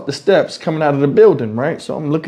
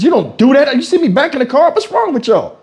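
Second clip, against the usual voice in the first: raised, higher scolding yell; 8 semitones higher than usual. Retold or imitated. Man scolding kids at his window, angry. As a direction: tell off the kids sharply.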